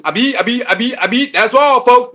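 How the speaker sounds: stammering cartoon pig, lightly voiced; Porky Pig stutter. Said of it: "Sound like Porky Pig".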